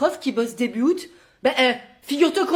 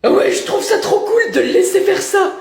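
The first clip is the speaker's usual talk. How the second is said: mocking high voice; 9 semitones higher, 5 dB louder than usual. Mimicking parents sarcastically.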